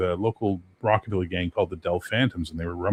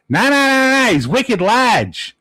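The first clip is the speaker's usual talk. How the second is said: put-on much higher and louder, slow Boston accent; 13 semitones higher, 8 dB louder, much slower than usual. A Boston guy stops his buddy from fighting, amused Boston accent. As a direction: drag out the Boston vowels with a loud high wicked. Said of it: "in this fucking amazing Boston accent".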